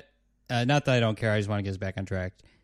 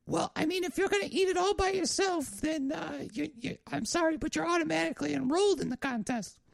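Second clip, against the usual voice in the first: high, softer falsetto, apologetic; diner waitress; 5 dB quieter than usual. Acted. Waitress says sorry, he is automatically enrolled, high-pitched waitress voice.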